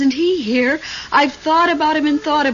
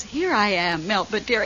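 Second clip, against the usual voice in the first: imitating Paul; 5 semitones lower, 6 dB quieter than usual. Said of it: "comes to the window and says".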